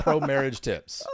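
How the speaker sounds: deadpan it like a fake ad slogan; sarcastic. Sarcastic sign-off after a joke.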